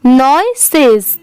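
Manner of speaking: नॉइसेस stretched out as NOY-ses with a falling end; slow model word. Shown as a demonstration. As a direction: say नॉइसेस slowly for learners to copy. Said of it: "ये है नॉइसेस". (नॉइसेस को धीरे और साफ़ बोलें)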